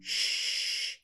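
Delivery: soft unvoiced श्श to hush someone; shushing sound. Shown as a demonstration. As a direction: shush gently. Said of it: "हम उसको बोलते हैं श्श". (किसी को चुप कराने वाला श्श)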